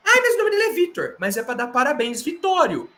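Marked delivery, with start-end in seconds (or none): 0.1-1.1 whiny viewer. Pretend. Mocking a nitpicking viewer.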